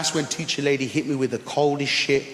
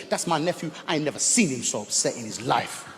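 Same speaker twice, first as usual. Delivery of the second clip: ordinary talk, nothing marked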